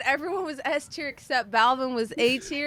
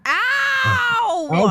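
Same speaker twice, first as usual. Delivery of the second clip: pained yelp; 14 semitones higher, 5 dB louder than usual. Acted. Massage hits a knot.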